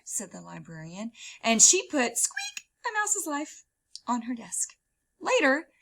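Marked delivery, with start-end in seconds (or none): none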